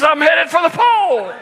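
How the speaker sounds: high, loud, laughing panic; panicked kid. Retold or imitated. Daughter wailing at dad while riding, panicked squeal. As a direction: squeal it as the pole nears.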